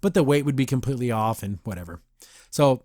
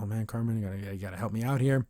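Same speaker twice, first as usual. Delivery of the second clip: softer, low plea for help; whisper-adjacent call under her breath; hushed plea; 5 semitones lower, 8 dB quieter than usual. Retold or imitated. Secretly calling Carmen for help, a soft hushed plea.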